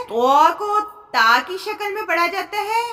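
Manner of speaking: ordinary delivery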